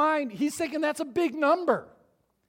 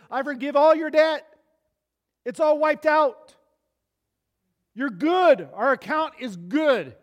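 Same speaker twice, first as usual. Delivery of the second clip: generous king; 3 semitones higher, 7 dB louder than usual. Acted. King pardons the servant's debt.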